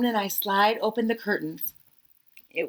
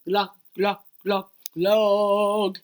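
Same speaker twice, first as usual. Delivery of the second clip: louder, odd fishy gluck sounds; fish glugging; 5 dB louder, much slower than usual. Retold or imitated. Norman the goldfish glugging along to the tuba, fishy gluck sounds. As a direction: mouth it like a goldfish. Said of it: "he mouths".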